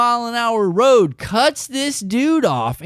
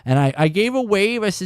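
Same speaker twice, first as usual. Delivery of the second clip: ordinary talk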